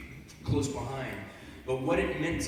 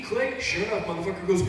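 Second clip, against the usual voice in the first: ordinary delivery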